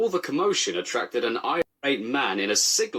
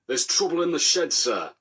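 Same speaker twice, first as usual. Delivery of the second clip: higher announcing voice; proclaimed; 6 semitones higher than usual. A narrator's reading. Station master to the boss, urgent high announcement. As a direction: declare it urgently. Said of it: "he proclaimed".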